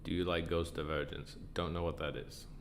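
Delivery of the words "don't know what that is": ordinary talk, nothing marked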